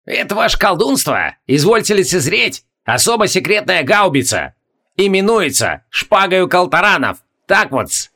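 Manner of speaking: high mocking gap-toothed gunner; gloating old gunner; snide gunner voice. A narrator's reading. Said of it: "едко хихикнул, демонстрируя пустоту на месте передних зубов, и провозгласил".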